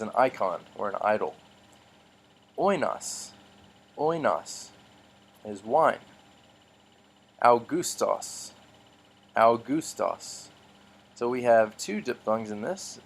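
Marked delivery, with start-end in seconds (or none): 2.6-4.7 "Oinos." as oy-nos, started high; model pronunciation. Shown as a demonstration. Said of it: "I'll pronounce these words in the middle". 7.4-10.5 "Augustos." slowly, ow-goos-tos; slow model pronunciation. Shown as a demonstration. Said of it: "I'll pronounce these words in the middle".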